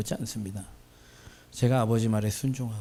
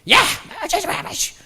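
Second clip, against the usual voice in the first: cranky professor yelling; 7 dB louder than usual. Retold or imitated.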